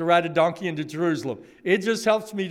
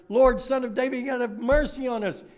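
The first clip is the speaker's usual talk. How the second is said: higher, louder pleading shout; desperate cry; 6 semitones higher, 4 dB louder, faster than usual. Acted. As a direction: call out over the crowd, begging. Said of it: "They shouted all the louder".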